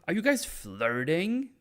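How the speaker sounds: high, slow teasing question; mock scandalized. Onlooker catching the pair flirting, mock scandalized. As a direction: draw it out, rising in pretend shock.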